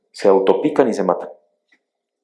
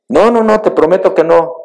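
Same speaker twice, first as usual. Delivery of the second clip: high, loud insistent promise; pleading scorpion; 11 semitones higher, 7 dB louder than usual. Acted. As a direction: coax the frog, overdoing the innocence.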